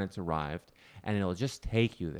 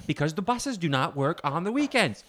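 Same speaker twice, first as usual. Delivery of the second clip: higher, insistent; defensive brother; 7 semitones higher than usual. Retold or imitated. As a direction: fend him off, pitched high. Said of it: "he's like".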